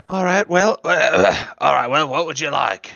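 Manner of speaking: lightly voiced gruff server; a hint of a gruff lizard. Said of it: "the original voice".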